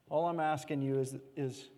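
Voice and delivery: low, soft and tender; hushed, tender voice of invitation; gentle invitation. Retold or imitated. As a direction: speak Jesus' words as a quiet plea. Gently voicing Jesus' invitation.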